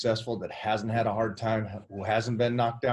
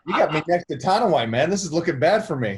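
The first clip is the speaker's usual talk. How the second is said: laughing through the words, louder; 5 semitones higher, 7 dB louder than usual. Real, not acted. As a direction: joke about yourself while laughing. Joking about being out-muscled.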